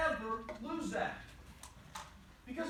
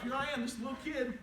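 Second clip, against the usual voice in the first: normal talking voice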